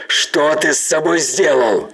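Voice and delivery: loud horrified shout. Acted. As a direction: demand an answer, shouting. Captain confronts the monster.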